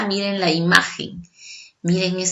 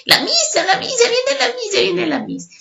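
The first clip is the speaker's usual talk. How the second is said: put-on much higher, sing-song children's chant; kids calling out; 14 semitones higher than usual. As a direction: classroom kids announcing the teacher. Children calling teacher arriving. (niños gritando en coro que viene la maestra)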